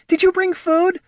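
high, loud little pup voice; eager pups. A narrator's reading. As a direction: ask like a hungry little pup. Pups calling from dumpster.

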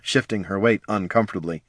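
usual speaking voice

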